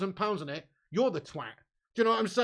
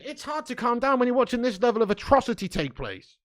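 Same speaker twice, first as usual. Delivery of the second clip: louder, exasperated protest; real frustrated rant, building louder; exasperated outburst; 3 semitones higher, 7 dB louder than usual. Real, not acted. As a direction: raise your voice in exasperation at the match. Exasperated answer to calm down.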